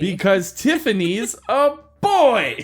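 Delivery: slow, high, loud reveal; dramatic shout. Retold or imitated. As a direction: announce it like a plot twist.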